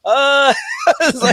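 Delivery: breaking into high laughter, slowed; laughing. Real, not acted. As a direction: laugh through the words, amused. Laughing while retelling fan moment.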